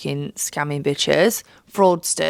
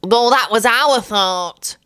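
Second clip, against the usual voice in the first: snooty seatmate; 4 semitones higher, 4 dB louder, slower than usual. Retold or imitated. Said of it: "pipes up".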